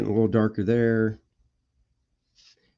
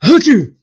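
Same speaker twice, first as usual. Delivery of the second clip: startled whoop; 11 semitones higher, 13 dB louder than usual. Pretend. Teeth pop out mid-bit.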